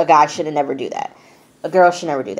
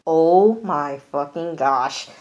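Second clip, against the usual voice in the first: drawn-out dismay; slower than usual. Retold or imitated. Imitates Sam's dismay at painting.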